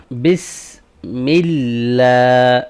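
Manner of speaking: drawn-out Quranic recitation of بسم اللہ, elongated vowels; tajweed demo, very slow. Shown as a demonstration. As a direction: chant بسم اللہ slowly, stretching اللہ. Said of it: "آج ہم صرف بسم اللہ کے حوالے سے پڑھیں گے".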